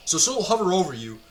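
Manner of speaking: normal talking voice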